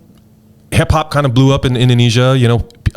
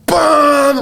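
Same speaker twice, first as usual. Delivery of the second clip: a sudden, much higher shouted "bam" like the band's first blast; imitated drum hit. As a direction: burst out with the drum hit, high and punchy.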